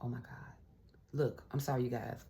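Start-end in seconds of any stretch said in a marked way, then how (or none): 0.0-0.5 hushed, almost no voice; awed whisper. Real, not acted. To viewers, over the tarot spread: a stunned whisper. Reader reacting to the cards.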